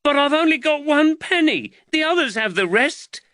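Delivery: high, loud, aggrieved lad; indignant youngster pleading unfairness; protesting boy. A narrator's reading. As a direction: wail it out in a high boy's voice.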